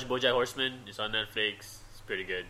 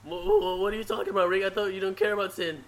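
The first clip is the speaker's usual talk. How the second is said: much higher, stammering; Morty voice; 5 semitones higher than usual. Pretend. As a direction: stutter it out way up high. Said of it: "I can do Morty".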